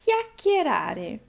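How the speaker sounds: Chiacchierare said slowly, hard k sounds; teacher demo; slow model word. Shown as a demonstration. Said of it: "falar mais rápido".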